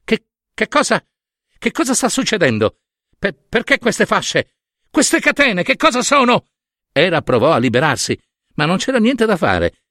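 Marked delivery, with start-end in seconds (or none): 0.0-6.5 high, stammering bewilderment; pitched-up startled queen; stunned. A narrator's reading. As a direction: stumble over the questions in alarmed astonishment. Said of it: "Stupita guardò Efesto".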